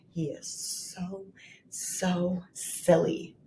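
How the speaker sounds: put-on soft playful aside to children; hushed silly. Shown as a demonstration. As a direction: drop to a hush on "so silly".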